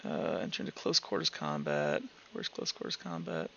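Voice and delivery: low, very quiet mutter while scanning a list; absently murmured search through the rules; muttering to oneself. Real, not acted. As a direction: read headings under your breath. Reads rule headings to self while searching.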